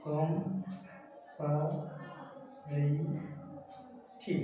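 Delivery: very slow কম-পা-রি-থি, four stretched beats; long pause after each syllable of কম-পা-রি-থি; split into syllables. Shown as a demonstration. Teacher asking for the count, কম-পা-রি-থি syllable by syllable. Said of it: "কয়টা syllable?".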